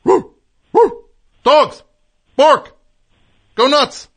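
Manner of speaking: hyping up the dogs; loud excited yelling to get barking; shouting at dogs. Real, not acted. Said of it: "Yes, let's do that".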